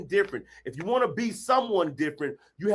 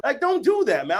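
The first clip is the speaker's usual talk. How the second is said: ordinary delivery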